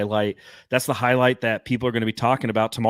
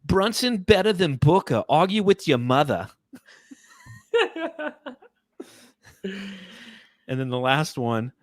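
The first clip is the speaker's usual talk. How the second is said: lightly put-on older New York lady accent, pitched higher; 4 semitones higher than usual. Reading Knicks subreddit comments to the co-host in a light older New York lady accent. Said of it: "like an older New York lady".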